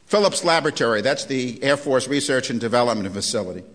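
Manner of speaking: normal talking voice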